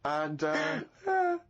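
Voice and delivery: normal talking voice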